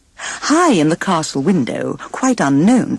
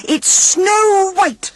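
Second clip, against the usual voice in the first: high, shrill jealous screech; queen screeching at the mirror's answer; much slower than usual. A narrator's reading. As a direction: screech the name in fury. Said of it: "screeched the queen".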